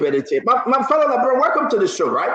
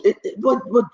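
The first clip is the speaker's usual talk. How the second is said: ordinary talk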